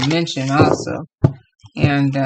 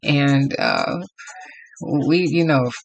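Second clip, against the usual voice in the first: ordinary delivery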